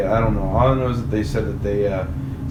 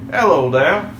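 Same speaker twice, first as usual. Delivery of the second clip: exaggerated old-school English "Hello there", higher and louder; old-school English accent; 7 semitones higher, 8 dB louder, slower than usual. Joking with a friend about English accents, hammed-up old English accent. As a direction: ham up a proper old English greeting. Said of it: "the really old school".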